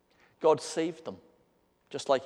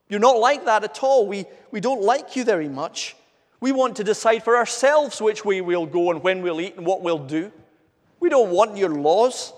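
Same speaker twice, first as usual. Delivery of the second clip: raised, louder mock protest; grumbling defiance; 5 semitones higher, 6 dB louder than usual. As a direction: push back at God as a rebellious people. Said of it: "that sort of grumbling heart".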